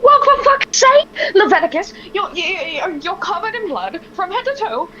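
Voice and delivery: high and loud, fed up; exasperated yell. Acted. Estrella yells at the bloodied Leviticus, exasperated. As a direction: blow up at him in frustration.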